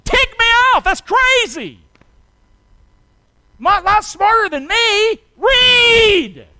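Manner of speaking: high, loud preaching shout. Real, not acted. Preacher to congregation, shouting his anger. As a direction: let it rip from the pulpit. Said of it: "I better hush".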